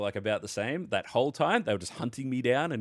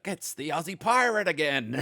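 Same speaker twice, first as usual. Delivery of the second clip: high mock-pirate holler; pirate impression; 8 semitones higher, 4 dB louder than usual. Rival pirate sighting the Aussie player, pirate impression. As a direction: mimic a pirate gleefully calling out his prey. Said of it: "they're acting like pirates".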